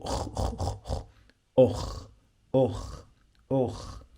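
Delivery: أخ with a rough throaty kh from the back of the throat, like a snore; snore-like kh sound. Shown as a demonstration. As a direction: scrape out أخ like a snore. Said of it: "when a person snores".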